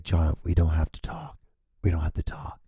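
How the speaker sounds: soft whispered plea to stop; whispering voice. Pretend. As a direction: let the voice whisper it. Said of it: "even my voice was like".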